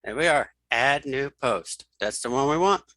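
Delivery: usual speaking voice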